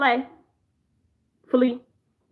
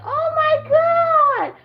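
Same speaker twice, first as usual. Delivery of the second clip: put-on very high, drawn-out gasp of an old lady; 14 semitones higher, 3 dB louder, much slower than usual. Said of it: "She's like".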